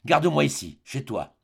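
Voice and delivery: usual speaking voice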